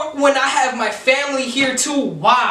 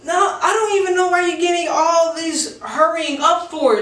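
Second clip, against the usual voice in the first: raised, mocking girly shout; high mock girl; 6 semitones higher than usual. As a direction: shout it like the indignant drunk girl. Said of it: "And she's all like".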